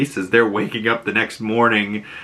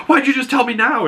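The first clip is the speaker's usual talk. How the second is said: high, urgent mock panic; retold alarm; 13 semitones higher than usual. Vigilante told his friend may be choking, high mock alarm. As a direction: blurt it out as an alarmed protest.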